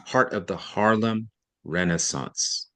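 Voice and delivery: Heart of the Harlem Renaissance, slow and deliberate; slow right down to stress it. Shown as a demonstration. Teacher showing emphasis by slowing down. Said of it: "See what I did there?".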